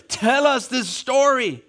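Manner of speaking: high, slow eager plea from the crowd; high pleading voice of the Ninevites. Retold or imitated. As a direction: beg for the story like an eager listener.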